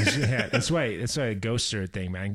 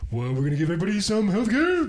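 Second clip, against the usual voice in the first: cartoon bear voice, higher and louder; Bernie Sanders as Yogi; 9 semitones higher, 6 dB louder than usual. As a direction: play a politician as a cartoon bear. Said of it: "Bernie Sanders sounds like Yogi the Bear".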